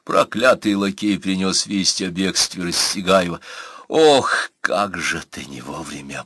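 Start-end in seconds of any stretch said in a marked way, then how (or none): 3.9-4.5 higher, louder pained "oh"; sudden exclamation of regret; dismayed sigh. Acted. Anna's dismayed thought at bad news. 4.6-6.2 low, hushed dismay; subdued, regretful aside; inward thought. A narrator's reading. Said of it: "подумала Анна".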